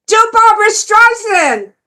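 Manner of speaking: high, loud audience shout; yelled request. Retold or imitated. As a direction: yell the request from the back of the room. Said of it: "someone would yell out".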